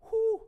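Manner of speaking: loud, high exhaled whew; relieved whew. Real, not acted. Preacher after God holds no grudge, loud relieved whew. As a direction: let out a big whew of relief.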